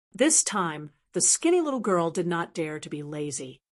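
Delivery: ordinary delivery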